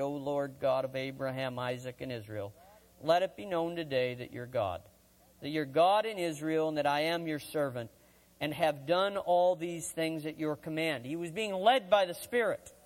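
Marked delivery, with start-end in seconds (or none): 0.0-11.1 hushed prayer. Retold or imitated. Elijah praying before the people in a low, hushed voice. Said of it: "stepped forward and prayed".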